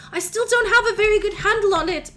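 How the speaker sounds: put-on high, loud, anxious young voice, slowing near the end; a frightened child pleading to be accepted; pleading changeling child. Boy pleads with the court for acceptance, high, anxious and loud.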